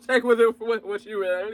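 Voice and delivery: talking through a laugh. Real, not acted. Reviewer amused at their own rambling, laughing. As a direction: let the laugh spill into the words.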